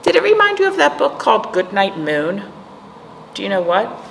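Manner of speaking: ordinary delivery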